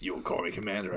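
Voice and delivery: low, soft, lightly menacing villain; a hint of a deep movie villain; generic villain voice. Quoting Cobra Commander's line, in a lightly done villain voice. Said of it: "That was a generic villainy voice".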